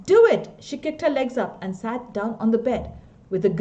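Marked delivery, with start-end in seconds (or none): 0.1-0.5 put-on much higher and far louder, a child's yelled demand; little girl shouting at her father to do it. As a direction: yell it at Daddy as an order. Child demanding the wizard story.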